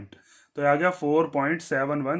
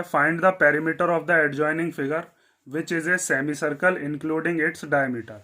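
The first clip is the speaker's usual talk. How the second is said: slow read-aloud; much slower than usual. Shown as a demonstration. Reads the question aloud slowly.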